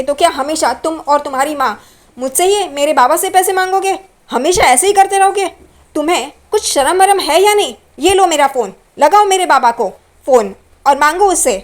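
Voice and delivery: furious scolding. Retold or imitated. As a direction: shame him hard. Roshni berates Tejas about money. (पैसों के लिए पति को गुस्से में डाँटती हुई)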